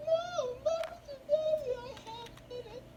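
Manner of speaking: very high, dragged-out falsetto plea; desperate pleading. Acted. Scolded son pleads with his mom, high desperate wail.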